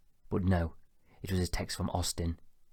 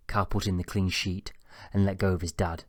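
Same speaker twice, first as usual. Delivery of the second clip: ordinary delivery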